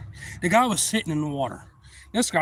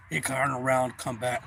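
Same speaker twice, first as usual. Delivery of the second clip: normal talking voice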